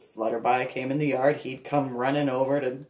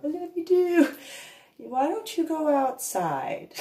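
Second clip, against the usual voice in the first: high, sing-song voice answering her son; high motherly reply; 13 semitones higher than usual. Retold or imitated. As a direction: coo it back to the boy, much higher.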